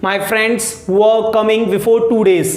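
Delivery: slow, careful teaching read; slow model sentence. Shown as a demonstration. Teacher modelling the translation for students, slowly. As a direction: spell it out slowly for learners. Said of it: "दो दिन पहले मेरे दोस्त आ रहे थे".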